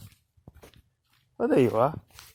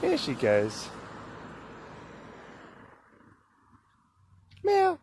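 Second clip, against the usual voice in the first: high calling voice; 14 semitones higher than usual. Real, not acted. Calls her cat Penelope home in a high voice.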